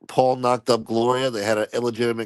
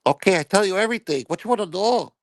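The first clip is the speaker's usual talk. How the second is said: higher, fast eager falsetto; frightened maid; 9 semitones higher, faster than usual. Retold or imitated. Maid Gloria caving to the FBI agents in a fast higher voice. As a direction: blurt it out quickly.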